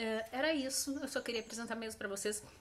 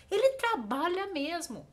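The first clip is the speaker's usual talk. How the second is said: loud, high stress on "trabalha mesmo"; emphatic intonation; 5 semitones higher, 7 dB louder than usual. Shown as a demonstration. Teacher shows the intonation people use, stressing "trabalha mesmo". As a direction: punch "trabalha" and "mesmo" insistently. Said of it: "faz essa entonação na voz".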